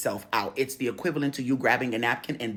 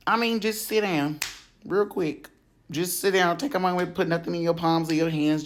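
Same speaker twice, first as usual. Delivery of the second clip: usual speaking voice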